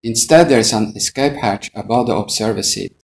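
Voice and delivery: normal talking voice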